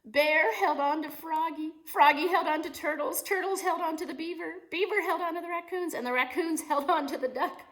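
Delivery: high, loud and eager, building up the chain; a thrilled reader's big moment; excited storytelling. Acted. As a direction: read each link with rising excitement. Climax of read-aloud.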